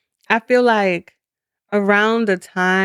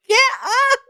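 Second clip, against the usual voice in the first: much higher imitation; mock high-pitched call. Teasing a friend about what she always says, high mock call.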